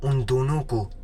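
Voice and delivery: usual speaking voice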